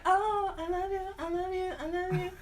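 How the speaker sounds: high, breathy-free swooning repeat of I love you; mock swooning lover; swooning sister. Sister answering his letters with mock swooning. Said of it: "she was like".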